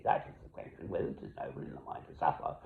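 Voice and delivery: trailing into a hushed whisper; hushed Hamlet recital; whispered recitation. Acted. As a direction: breathe the lines out almost silently.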